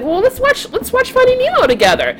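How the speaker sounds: high, coaxing put-on invitation; luring man. Man coaxing a child to watch a movie, high and sweet. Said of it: "he's like".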